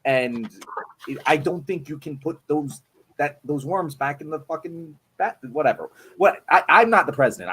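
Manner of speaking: usual speaking voice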